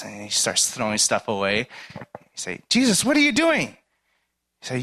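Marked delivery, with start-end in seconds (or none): 2.7-3.8 alarmed protest. Retold or imitated. Homeowner protesting to Jesus, high alarmed cry.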